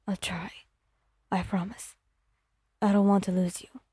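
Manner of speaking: barely above a murmur, then steadier; quiet promise. Acted. Bakugo promising Wyon not to lose her, hushed.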